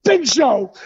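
high starstruck exclamation; imitated excited cop. Cop spotting a famous wrestler, imitated excitement. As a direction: call out the idol's name like a fan. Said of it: "he is so excited".

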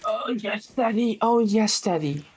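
high, breathy mock moan; hammed-up pleasure moan. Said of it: "Whoa, man".

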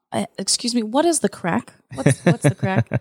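mocking an American try at Irish slang; clumsy tourist asking what's the crack; bad Irish imitation. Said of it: "we say".

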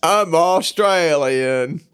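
slow, drawn-out mock Australian; Australian accent. Cohost proving himself in accent challenge, hammy Australian accent. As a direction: stretch it in an amateur Australian accent. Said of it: "accent challenge. Australian, go".

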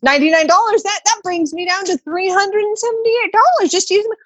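sweetly spelling out the obvious, pitched high; mock-reasonable pleading. Retelling her pitch to the agent, in a high faux-sweet voice. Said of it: "I was like".

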